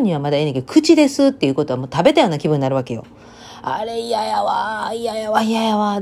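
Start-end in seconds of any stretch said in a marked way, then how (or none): 3.6-6.0 slow, drawn-out whining disgust; whiny disgust. Real, not acted. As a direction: moan each 嫌やわ in disgust. (臭いを思い出してうんざりと嘆く)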